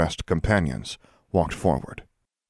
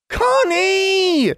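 put-on high, loud child scream; child shouting; 5 dB louder than usual. A narrator's reading. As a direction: scream like a scared little boy. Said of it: "screamed the boy".

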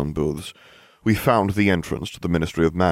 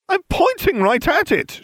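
very high, insistent cry; exasperated cry; 4 dB louder than usual. A narrator's reading. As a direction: cry it out in frustration. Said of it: "she cried".

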